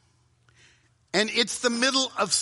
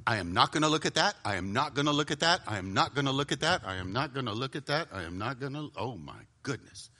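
quick low chant fading to a near-murmur; low self-talk sinking ever softer; weakening resolve; 6 semitones lower, 5 dB quieter than usual. Acted. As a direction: repeat it under your breath, losing the fight. Acting out failing to resist temptation.

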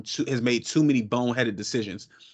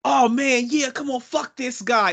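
high-pitched mock hype, loud start; Corey; 10 semitones higher, 4 dB louder than usual. Imagining calm friend cursing a guy, high mock outburst. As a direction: play the calm friend suddenly trash-talking, pitched way up. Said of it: "Corey was like".

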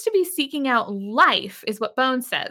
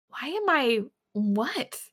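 slow, halting, puzzled question; soft and baffled, drawing out the words; acted bewilderment; confused; 5 dB quieter, slower than usual. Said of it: "she's very confused".

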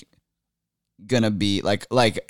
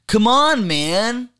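high, drawn-out slacker protest; the Dude; 9 semitones higher, slower than usual. Pretend. Guest playing a Big Lebowski character, high slacker whine. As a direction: plead like a put-on movie slacker. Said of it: "Oh, you could do the dude".

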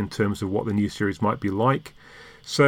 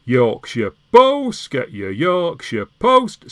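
slow drawn-out Yorkshire street seller's call, higher at the end; newspaper seller's cry; 6 semitones higher, 5 dB louder, much slower than usual. Retold or imitated. Remembering a Leeds paper seller, slow drawn-out street cry. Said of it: "the newspaper seller used to say".

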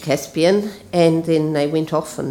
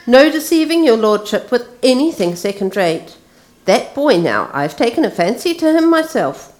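higher, fawning slaver's voice; a slave trader selling a boy, wheedling high; 6 semitones higher than usual. Acted. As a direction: grovel to the lord, oily and eager. Slaver Pug fawning over lord.

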